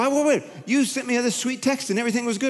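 high, puzzled wife; a lightly voiced wife, pitched high; wife's surprised protest. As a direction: pitch up, baffled and hurt. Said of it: "And she'll go".

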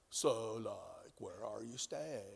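low, soft, slow mock-dopey voice; dim-witted disciple. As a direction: play a clueless disciple, slow and deep. Mocking the disciples' dumb question.

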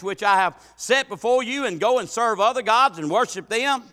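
loud, high preaching voice; high and forceful, ringing out the verse; forceful scripture reading. Acted. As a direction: proclaim the scripture from the pulpit. Reads scripture at full force.

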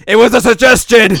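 very loud, high-pitched mock yell; screamed protest. Azusa snapping at Mio over tea, screaming. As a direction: explode in hammed-up indignation. Acted outburst repeated much louder.

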